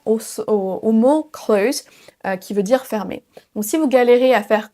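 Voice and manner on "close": CLOTHES said like CLOSE, plain Z ending; version simplifiée. Shown as a demonstration. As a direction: drop the TH in CLOTHES. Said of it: "ça ressemble quasiment". (CLOTHES prononcé comme CLOSE)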